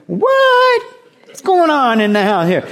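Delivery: high, loud, squealed mock astonishment; hammed-up disbelief. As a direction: cry out in exaggerated comic shock. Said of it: "I don't know exactly how, how he said this. Could be".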